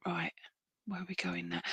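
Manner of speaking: ordinary delivery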